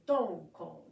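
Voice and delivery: "don, kon" chanted hard and loud like a strong drum hit. Shown as a demonstration. Teacher chanting "don, kon" loudly for students. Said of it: "the count is gonna be".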